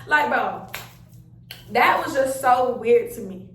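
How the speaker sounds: ordinary talk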